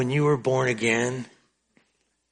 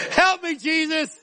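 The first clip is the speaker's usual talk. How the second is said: laughing plea; 13 semitones higher than usual. Real, not acted. Still laughing at own joke.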